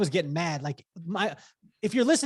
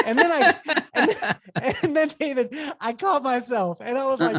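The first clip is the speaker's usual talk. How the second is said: laughing; 6 semitones higher, 5 dB louder than usual. Real, not acted. Laughing at his own story.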